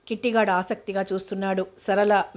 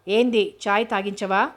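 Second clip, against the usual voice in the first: ordinary delivery, nothing marked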